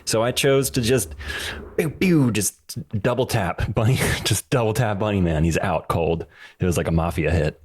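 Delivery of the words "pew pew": laser sound effect. Pretend. Mimicking laser shots.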